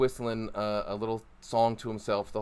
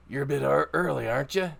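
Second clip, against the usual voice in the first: higher-voiced challenging guard; stronghold guard; 4 semitones higher, 3 dB louder, faster than usual. Guard walks over to the early arrivals, challenging them. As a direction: question the visitors as a guard on duty. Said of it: "two of the four guards".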